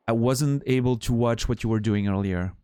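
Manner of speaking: ordinary talk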